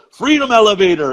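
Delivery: lightly imitating a friend's proud joke; Jimmy's quip. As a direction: toss it off as a cheerful one-liner. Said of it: "and Jimmy goes".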